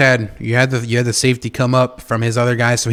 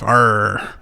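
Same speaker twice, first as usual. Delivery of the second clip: pirate growl; 4 semitones lower than usual. Pretend. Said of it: "I feel like I'm talking like a cowboy".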